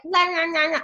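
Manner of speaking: put-on high-pitched baby-voice babble; imitating toddler son. Mom imitating her two-and-a-half-year-old conversing, high baby voice. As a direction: mimic a chattering toddler up high. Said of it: "Landon's articulate baby voice".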